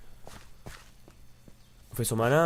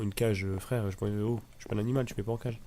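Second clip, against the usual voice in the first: ordinary talk, nothing marked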